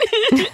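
high laugh. Real, not acted. Laughing at the memory.

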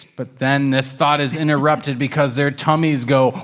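usual speaking voice

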